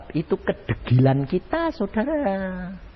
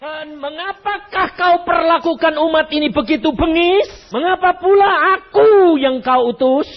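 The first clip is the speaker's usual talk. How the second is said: pleading protest; 10 semitones higher than usual. Acted. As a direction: cry out at God in protest.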